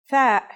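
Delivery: tha with TH as in "thief"; voiceless TH. Shown as a demonstration. Teacher recalling an earlier letter, tha with voiceless TH. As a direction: say tha with an unvoiced TH. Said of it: "pronounced as a silent T H".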